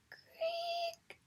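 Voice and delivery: high, thin creak; a door in a tree creaking open. A narrator's reading. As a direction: creak like an old door opening. Door opens, spoken sound.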